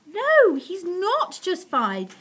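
high, loud, drawn-out protest; crying out. A narrator's reading. Missus Silver contradicting Mister Hoppy, loud high protest. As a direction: object loudly, leaning on each word. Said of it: "Missus Silver cried".

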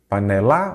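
PANELA said as pa-ne-LÁ, rising on LA; stress on last syllable. Shown as a demonstration. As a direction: wrongly stress the final LA of panela. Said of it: "Qual que é a sílaba tônica?". (panela com a tônica no LA final)

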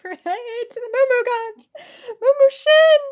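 high, loud wail, building; anguished wail. Real, not acted. Ticket buyer calling on Moo Moo Shin, distraught. Wailing while waiting in queue.

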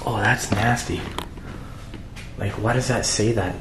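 ordinary delivery

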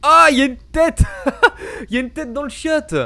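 nervous laughing shriek; high scared laugh; panicking gamer. Real, not acted. Sees a head in toilet.

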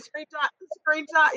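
eager urging. Retold or imitated. Urging herself to save messages.

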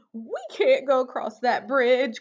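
high-pitched worried objection; worried cry. A narrator's reading. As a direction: cry out in protest as an anxious goat. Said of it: "cried Middle Billy Goat Gruff".

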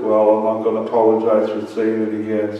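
ordinary delivery

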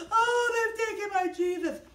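high, hammed-up wail; mock crying. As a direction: wail as a storyteller's weeping Mary. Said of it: "And she's crying".

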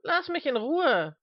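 high, loud, drawn-out whine; wailing. Acted. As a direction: whine it out slowly at a high pitch. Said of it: "jammerte Mister Smith".

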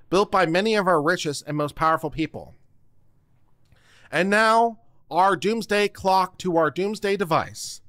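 ordinary delivery

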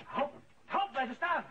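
a high, loud yell to stop; startled shout. Acted. Priest to the burglar, a startled high shout. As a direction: bark at him to freeze.

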